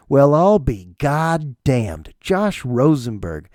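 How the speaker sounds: very slow, savoured astonishment; slow amazement. Retold or imitated. Old friend replying after decades apart, slow amazement. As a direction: stretch out each word in retold disbelief.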